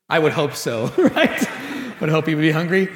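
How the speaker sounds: amused, laughing through the words. Real, not acted. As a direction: chuckle at your own joke. Amused at own Scripture joke.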